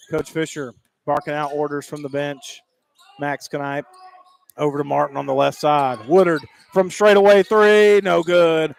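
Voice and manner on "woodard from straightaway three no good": loud, raised game call; excited play-by-play. Real, not acted. Announcer calls Woodard's straightaway three and the tip, excited play-by-play. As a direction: ride the shot and the tip with urgency.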